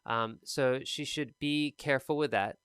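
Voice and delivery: ordinary delivery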